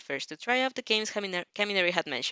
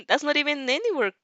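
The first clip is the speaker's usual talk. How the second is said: proud boast; 6 semitones higher, 3 dB louder than usual. Acted. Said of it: "he proudly boasted".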